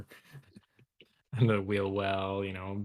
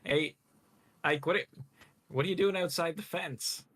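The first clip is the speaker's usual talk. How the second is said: higher-pitched calling across a fence; mild acted yell; 8 semitones higher than usual. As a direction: call out in a half-raised, questioning yell. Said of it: "First, I'll yell".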